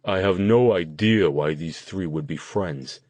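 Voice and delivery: slightly deepened put-on voice. A narrator's reading. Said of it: "changing his voice to make it sound deeper".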